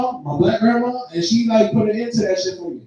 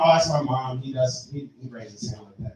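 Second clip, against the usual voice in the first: put-on girlfriend excuse; 7 semitones lower, 8 dB quieter than usual. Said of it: "She try to blame it".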